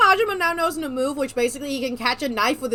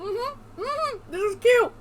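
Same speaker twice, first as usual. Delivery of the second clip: delighted gushing; 10 semitones higher, much slower than usual. Real, not acted. Player gushes over the scene, high and delighted. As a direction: squeal it a little.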